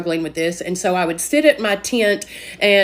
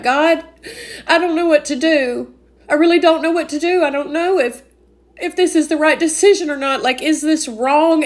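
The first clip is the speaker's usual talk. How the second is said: scared pleading prayer; 5 semitones higher than usual. Retold or imitated. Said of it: "Because I was so scared".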